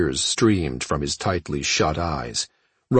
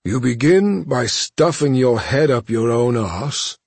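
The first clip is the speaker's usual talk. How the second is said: slow contemptuous retort; scornful captive voice; 7 semitones higher, 4 dB louder than usual. Acted. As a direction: sneer it out slowly. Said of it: "he said".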